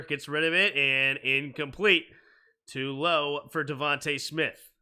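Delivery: sportscaster calling the snap; play-by-play. Acted. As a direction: call it like live TV commentary. Calls the game play-by-play.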